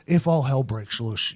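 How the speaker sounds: low, slow, soft coach voice; subdued, deliberate warning; imitated coach. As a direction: drop low and measured like the coach. Coach's line quoted low.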